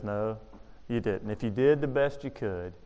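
low, hushed, solemn answer; gentle but firm correction, very soft; quiet divine reproof. Acted. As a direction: drop right down and reprove softly. God answers the excuse.

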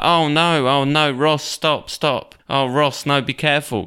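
high-pitched mock alarm; Rachel. Rachel warns Ross about the boy on his back, imitated alarm.

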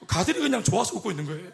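ordinary talk

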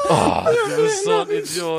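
high, slow, laughing exclamation; squealing with amused outrage; laughing disbelief. Real, not acted. Teammate's fake-out makes him laugh.

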